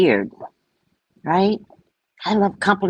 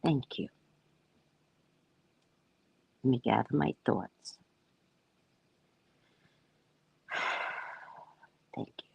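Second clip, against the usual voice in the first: much softer, near-whispered thanks; emotional hush; 5 semitones lower, 15 dB quieter than usual. Real, not acted. Moved by Ori's words, hushed tearful thanks. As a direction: barely get the thanks out, moved.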